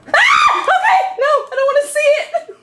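very high, loud squeal of fright; scream, then squeal in protest; real high-pitched bug panic; panicked shriek. Real, not acted.

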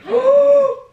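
sharp high shocked gasp; loud gasp. Real, not acted. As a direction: gasp in horror. Sees the cut bangs.

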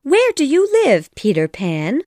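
put-on high-pitched girl's voice, questioning; high girl character asking a crying boy; Wendy. A narrator's reading. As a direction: ask in a high, lifted little-girl voice. Narrator voices Wendy questioning Peter.

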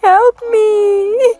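high, rising crying plea for help; ghostly wail. Acted. Bloody ghost lady pleading.

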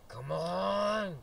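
high, loud, drawn-out yell; exasperated shout. Real, not acted. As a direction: yell it in frustrated urging. Urges the game on, louder repeat.

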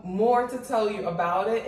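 ordinary talk

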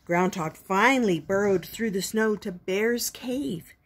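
ordinary delivery

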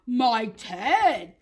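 loud storybook gasp; lightly acted gasp of the bear. A narrator's reading. Bear finds his Ted, acted gasp. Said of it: "gasped the bear".

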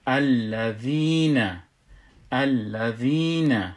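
الذین slowly, with a long-held ī; madd pronunciation. Shown as a demonstration. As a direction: stretch the ī in الذین before the final na. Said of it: "الذین نمیشه".

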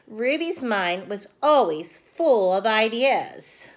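ordinary delivery, nothing marked

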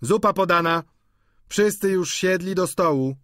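high, loud dinner announcement; calling out. A narrator's reading. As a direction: servant calling that the meal is served. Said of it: "zawołał Krzysztof".

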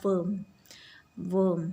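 "worm" said with เออ; pronunciation demo. Shown as a demonstration. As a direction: clear model of "worm" for children. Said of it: "worm นะคะ สระเออ". (ออกเสียง "worm" ชัดๆ ด้วยสระเออ)

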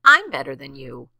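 loud, indignant rabbit retort; snapping boast. A narrator's reading. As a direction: snap it back with emphatic pride. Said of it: "snapped the rabbit".

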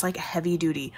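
ordinary delivery

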